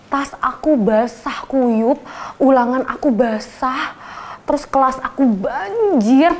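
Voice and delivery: ordinary talk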